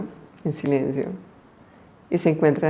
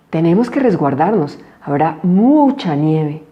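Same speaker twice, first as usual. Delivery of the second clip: mother bear; 6 dB louder, slower than usual. A narrator's reading. Mother bear voiced in story.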